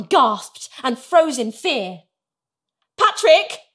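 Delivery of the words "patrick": high, loud call; raised, urgent mum voice; alarmed shout. A narrator's reading. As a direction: shout for your husband in alarm. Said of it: "she shouted".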